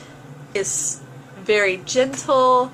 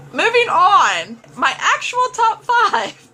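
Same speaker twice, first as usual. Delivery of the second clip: perky announcement; 10 semitones higher, 5 dB louder than usual. Real, not acted. Perky transition to the list.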